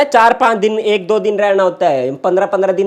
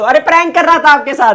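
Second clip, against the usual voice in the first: high, raised, hurried-to-explain reveal; urgent confession; 8 semitones higher, 4 dB louder than usual. Real, not acted. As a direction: blurt out that it was a joke.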